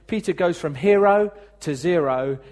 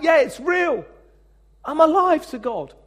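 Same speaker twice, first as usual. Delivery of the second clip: higher and louder, full of joy; excited realization; 11 semitones higher, 7 dB louder than usual. Retold or imitated. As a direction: burst out as if faith just clicked. Recalling a moment of faith.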